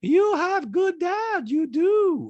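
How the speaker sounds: very high, loud and slow; drawn-out, squeaky caricature of an accented mother; Diana impression. Mother praises dad to daughter, high slow caricature. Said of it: "And Diana's like".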